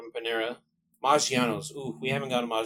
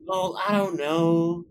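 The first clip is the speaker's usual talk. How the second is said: drawn-out, higher imitation of an indecisive girlfriend; slow, higher-pitched mock dithering; mock indecision; 5 semitones higher, 3 dB louder, much slower than usual. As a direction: drag it out, unable to choose. Imitating girlfriend unable to decide.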